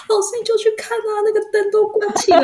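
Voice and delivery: a student hinting something is wrong; urgent student. Retold or imitated. Student voice again. (学生高声神秘地催促)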